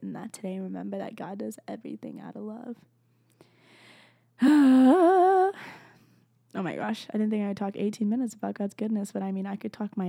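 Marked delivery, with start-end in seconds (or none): 4.4-5.8 loud, high, long sigh; big sigh. Real, not acted. Podcaster finishes talking about God's love, big loud sigh. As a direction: let out a big voiced sigh. Winding down after long heartfelt talk.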